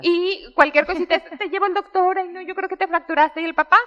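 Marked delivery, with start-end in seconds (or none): 1.2-3.4 higher, fretting and fast; imitating an overanxious mother; mock worried mom. Voicing anxious mother.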